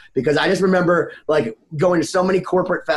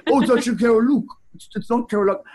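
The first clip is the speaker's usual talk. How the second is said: higher-pitched, badly faked Canadian accent; 5 semitones higher, faster than usual. Imagining the bandmate correcting the name, bad Canadian accent. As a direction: attempt a Canadian accent and miss. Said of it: "I can't do a Canadian accent".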